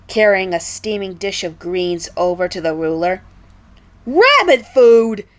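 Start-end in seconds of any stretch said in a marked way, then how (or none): 4.1-5.3 loud, slow disgusted yell; furious yell. A narrator's reading. King Pig yelling furiously at the chef. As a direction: spit out the insult. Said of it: "the King Pig yelled furiously".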